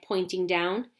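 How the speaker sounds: ordinary delivery, nothing marked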